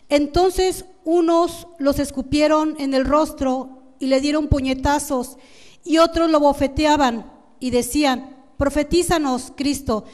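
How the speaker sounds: slow, emphatic Bible reading; scripture reading. Acted. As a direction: read the verse out firmly, measured and deliberate. Reads the Bible verse aloud. (lectura bíblica enfática)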